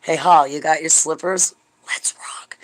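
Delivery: hushed unvoiced whisper; whispered Lori impression. Imagining Lori inviting her, whispered. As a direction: whisper it conspiratorially. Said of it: "Lori will be like".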